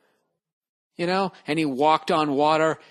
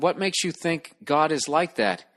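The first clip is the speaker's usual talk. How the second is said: ordinary talk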